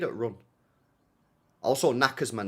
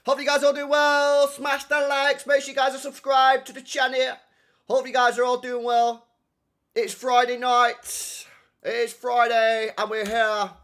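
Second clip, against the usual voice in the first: high, loud, drawn-out hype welcome; big slow stream-opening call; stream announcer intro; 14 semitones higher, 7 dB louder, slower than usual. Pretend. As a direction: hold each phrase on one high, stretched note.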